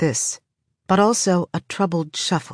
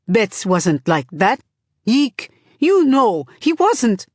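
slow protest climbing high and loud; grieving protest; 4 semitones higher, 4 dB louder, slower than usual. A narrator's reading. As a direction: plead for the dead, voice rising. Grieving brother protests to Ika.

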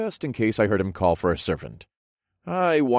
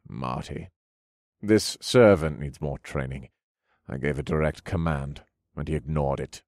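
haughty nobleman; 5 semitones lower, 3 dB quieter than usual. A narrator's reading. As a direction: complain about the servant. Duke complains to Marty.

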